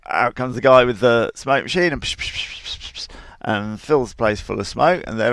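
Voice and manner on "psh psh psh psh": usual speaking voice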